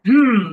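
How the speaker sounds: loud, disapproving "hmm"; glaring hum. Acted. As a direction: hum a wordless warning. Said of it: "I look at him like".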